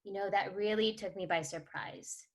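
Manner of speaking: soft, measured parent checking in; role-played calm, quiet concern; empathetic parent. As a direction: gently ask an upset child, with pauses. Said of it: "practice an empathetic parenting and ask questions and take a pause".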